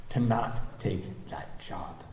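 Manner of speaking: slow, breathy whispered resolve; soft slow whisper on not take that job. Real, not acted. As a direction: hush it, slowly, like a confession. Quiet resolve, not taking job.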